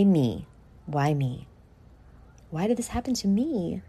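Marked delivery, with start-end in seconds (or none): none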